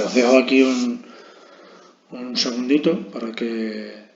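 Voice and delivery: usual speaking voice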